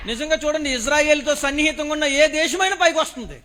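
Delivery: loud, ringing declaration with a laugh; fiery preaching. Acted. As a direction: proclaim it with joyful conviction. Preaching at full force.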